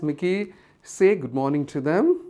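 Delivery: usual speaking voice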